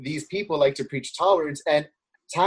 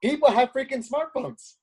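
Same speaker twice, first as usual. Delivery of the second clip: much higher, exclaiming in disbelief; incredulous; 11 semitones higher than usual. Retold or imitated. Walking around the projects rebutting the argument, incredulous. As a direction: pitch it way up, baffled by the claim. Retold astonished reaction in the projects.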